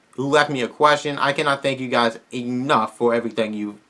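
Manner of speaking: ordinary delivery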